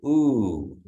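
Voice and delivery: long rounded ooh; teacher models the U sound; Kiowa ooh. Shown as a demonstration. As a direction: say ooh with lips pushed forward. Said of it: "it's a double O".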